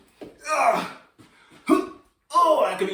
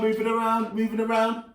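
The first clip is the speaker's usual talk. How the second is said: usual speaking voice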